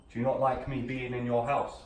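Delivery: usual speaking voice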